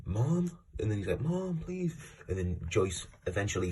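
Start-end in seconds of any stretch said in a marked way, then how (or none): 0.0-0.5 mock shout of a boy calling his mother; imitated shout for mom. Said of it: "he's shouting". 1.2-1.9 high, drawn-out plea without much volume; imitated pleading shout. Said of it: "he's shouting".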